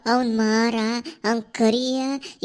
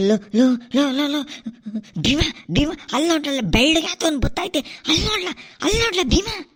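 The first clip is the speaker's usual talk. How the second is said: high, fast scared cry of look there; frightened pointing; 4 semitones higher, much faster than usual. Acted. Villager shrieking at Bhima to look at the ghost.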